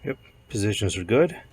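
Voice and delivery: hoarse croaky voice from a cold; croaky, a bit sick. Real, not acted. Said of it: "ignore the, uh, croaky voice".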